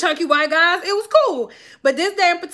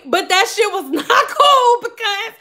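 laughing through the words; half laughing while telling it; 7 semitones higher, 4 dB louder, slower than usual. Real, not acted.

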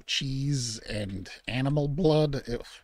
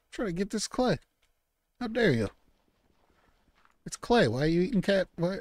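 upset scolding; 11 semitones higher than usual. Acted. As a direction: shoo off a pest fish, outraged. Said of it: "I sounded upset".

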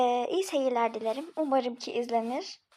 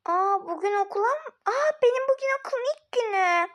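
put-on higher, bright little-girl realization; excited child; 6 semitones higher than usual. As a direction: gasp with sudden childish delight.

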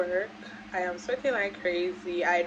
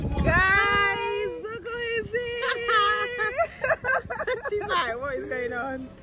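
much higher, tearful voice breaking with joy; emotional crying; 11 semitones higher, 4 dB louder, much slower than usual. Real, not acted. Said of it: "Why am I so emotional?".

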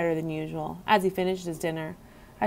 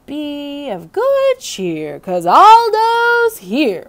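slow, higher, booming rhyme called out; announcing custodian; 7 semitones higher, 7 dB louder, much slower than usual. A narrator's reading. Custodian entering the dark room, slow booming announcement. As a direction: announce your arrival with a flourish. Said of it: "a voice announced".

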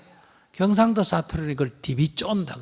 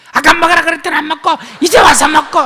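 high, loud exasperated nag; mother nagging the child who skipped dinner; irritated nagging; 12 semitones higher, 12 dB louder, faster than usual. Shown as a demonstration. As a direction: complain at the late child. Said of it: "이러면 안 돼요".

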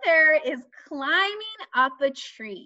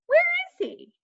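playful question. Acted. Searching with kids.

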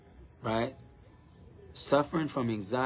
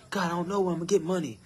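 higher whiny pleading to God; mock worried prayer, pitched up; mock anxious complainer; 6 semitones higher, faster than usual. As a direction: imitate a fretting believer begging God for money. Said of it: "y'all just saying".

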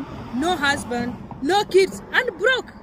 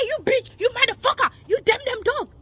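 angry retold yell; 6 semitones higher than usual. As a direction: fling the insults at her. Retold fight, cursing at woman.

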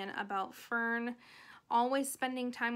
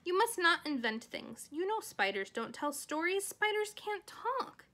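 stern scolding, higher and emphatic; stern mother; 7 semitones higher, 3 dB louder than usual. Acted. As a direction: lay down the rule like a cross mother. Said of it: "said her mother sternly".

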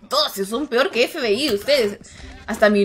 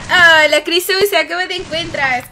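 normal talking voice

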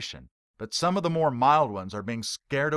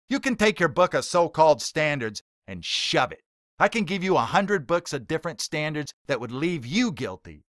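louder, higher, contemptuous rant; emphatic rant; 4 semitones higher, 5 dB louder than usual. Acted. Heckler ranting at the preacher, loud and contemptuous. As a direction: let the contempt boil over. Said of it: "Billy Mack emphatically states".

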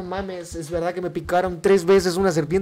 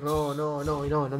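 usual speaking voice

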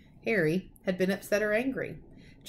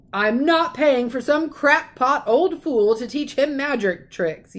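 higher, louder, slow yell; 6 semitones higher, 6 dB louder, slower than usual. A narrator's reading. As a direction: bellow in outrage. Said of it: "yelled Uncle Vernon".

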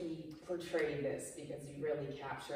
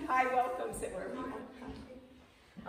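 welcoming aside; 7 semitones higher, slower than usual. Real, not acted. Greeting newcomer arriving.

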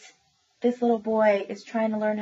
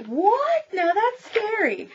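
much higher, louder squeal of a frightened dog; startled dog; 12 semitones higher, 6 dB louder, much slower than usual. Acted. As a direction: let the dog yelp in alarm. Dogs react to the dark.

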